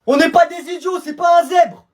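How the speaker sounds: loud, high outrage; indignant protest. Acted. Pupil disputing the zebra, loud indignant protest. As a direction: insist you've been cheated.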